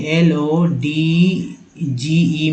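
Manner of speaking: एलओडीजीई spelled out very slowly letter by letter; slow spelling. Shown as a demonstration. Teacher slowly spells एलओडीजीई for the class. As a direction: stretch each letter out. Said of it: "और लॉज का देखिए".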